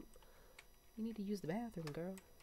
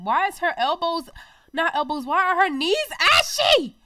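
much higher, louder incredulous shout; shocked outburst; 10 semitones higher, 16 dB louder than usual. Real, not acted. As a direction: yell it in disbelief at her knees. Shocked at her ashy knees.

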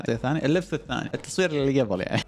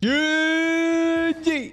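stretched-out gg; 11 semitones higher, much slower than usual. Real, not acted. Ending the game with a flourish.

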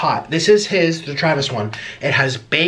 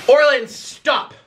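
slow, loud yell of his name; shouting; 9 semitones higher, 3 dB louder, much slower than usual. Real, not acted. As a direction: shout him down. Yelling at the co-host.

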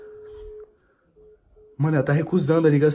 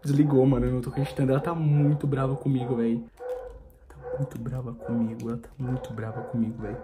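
hushed aside; 6 semitones lower, 9 dB quieter than usual. Real, not acted. Prankster telling viewers his girlfriend is angry, hushed aside. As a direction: mutter it under the breath so she can't hear.

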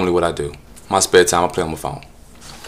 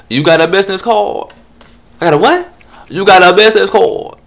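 much higher, loud camp voice; mock prissy guy; 8 semitones higher, 13 dB louder than usual. Solicitor repeating his name, high and camp.

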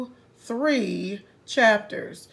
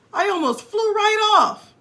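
high, louder excited boast; breathless thrill; 8 semitones higher, 6 dB louder than usual. Acted. Rabbit after the swing, high and thrilled. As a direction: tell how wild the ride was.

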